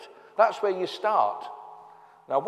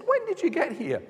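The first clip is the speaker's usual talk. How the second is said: much higher, incredulous question; surprised query; 10 semitones higher than usual. Retold or imitated. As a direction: blurt it out in disbelief. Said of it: "they queried it".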